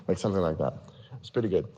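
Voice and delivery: usual speaking voice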